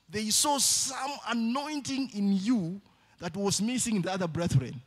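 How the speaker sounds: loud, high sermon cry to the church; preaching at full force. Acted. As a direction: preach it out, pushing every word.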